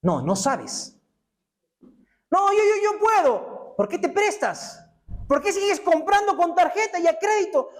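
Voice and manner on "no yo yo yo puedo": much higher, stammering mock insistence; stubborn insisting. Preacher mocks the debtor insisting he can manage, much higher. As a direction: sputter "yo, yo, yo" like a proud child. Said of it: "Es esa persona que dicen".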